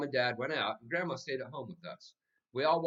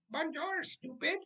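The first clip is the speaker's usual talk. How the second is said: very high squawking bird voice; mynah bird; slower than usual. A narrator's reading. The mynah bird greeting everyone in French, high bird squawk. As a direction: squawk it like a parrot. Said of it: "he greeted me with".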